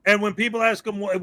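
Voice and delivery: normal talking voice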